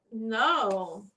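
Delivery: ordinary delivery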